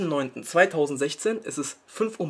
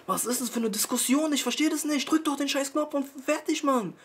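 much higher, exasperated pleading rant; 9 semitones higher than usual. Real, not acted. Vlogger ranting at viewers who won't subscribe, exasperated and high. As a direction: throw up your hands at the viewers, voice climbing high.

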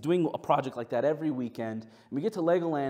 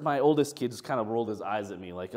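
ordinary talk

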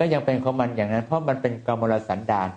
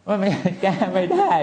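laughing repeat; 8 semitones higher, 3 dB louder than usual. Real, not acted. Monk repeating to the doctor, laughing and much higher. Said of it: "แต่มันแก้ไม่ได้".

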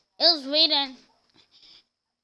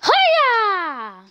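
high, loud mock karate cry; imitating the game's kid yelling Hiya; game ninja shout; 7 semitones higher, 7 dB louder than usual. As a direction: belt it like a cartoon ninja kid. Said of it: "at the end it said".

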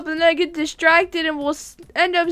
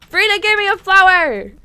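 high, loud gleeful announcement; excited bragging; 4 semitones higher, 9 dB louder than usual. Real, not acted. Player tells Walgreens of Frina's gift, loud and excited. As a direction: shout the good news proudly.

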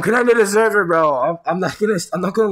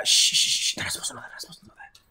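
breathy hushed whisper; 6 dB quieter, much faster than usual. Real, not acted. Hushing his friends so viewers won't know, whispered. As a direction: whisper it so they can't hear.

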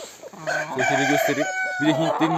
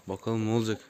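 ordinary talk, nothing marked

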